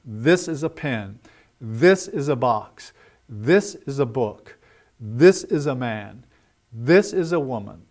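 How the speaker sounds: measured model sentences, "This" lifted, noun falling; stressed rhythm pattern. Shown as a demonstration. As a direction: drill with beats on "This" and "pen", "box", "book", "man", "woman". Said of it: "listening for the rhythm patterns".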